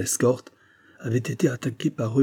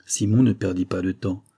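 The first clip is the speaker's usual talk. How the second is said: ordinary delivery, nothing marked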